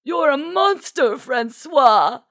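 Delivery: very high, loud accusing cry; high-pitched indignant denunciation; scolding outcry. A narrator's reading. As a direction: shriek it as an outraged accusation. Duchess accuses, wagging her finger.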